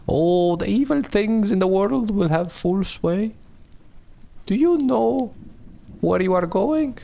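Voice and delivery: very high falsetto woman's voice, drawn out slowly in places; old woman's warning. Acted. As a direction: warn in a put-on, high old-lady voice. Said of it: "a woman's voice".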